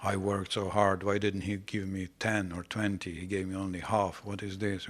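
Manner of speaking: low, soft mock grumble; grumpy murmuring. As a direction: grumble under your breath like a sulky complainer. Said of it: "not very loudly saying, grumble, a little grumpy and grouchy".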